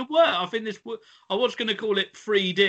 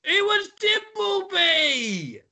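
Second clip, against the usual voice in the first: high, slow, drawn-out cry; hammed-up quizmaster exclamation; mock-outraged reveal; 13 semitones higher, much slower than usual. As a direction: belt out the answer in mock exasperation. Quizmaster mock-outraged at wrong guesses.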